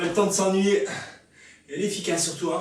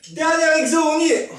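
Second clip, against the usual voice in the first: excited shout; 10 semitones higher, 9 dB louder than usual. Real, not acted. Coach hyping last exercise.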